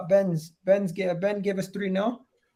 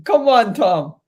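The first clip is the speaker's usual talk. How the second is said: louder, higher plea; exasperated protest; 3 semitones higher, 10 dB louder, slower than usual. Real, not acted. Host objecting to Tom's losing prediction, loud exasperated protest. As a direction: raise your voice in exasperated appeal.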